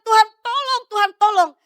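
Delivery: high, urgent re-enacted plea; retold groaning plea. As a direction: act out begging God for help. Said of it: "di saat kami mengerang". (meniru rintihan minta tolong)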